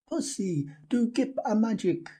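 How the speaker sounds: put-on high, eager child's voice; child pleading. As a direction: coax the rabbit like a small boy. Child begs rabbit for magic.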